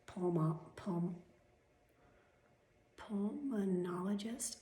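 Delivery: "pulmonologist" slow and drawn out, rising; "pulmonologist" stretched into an unsure question; sounding it out. Real, not acted. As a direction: feel through "pulmonologist" syllable by syllable. Sounding out a difficult word.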